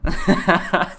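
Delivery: loud bright laugh, pitch up; burst of laughter. Real, not acted. Laughing at own language list.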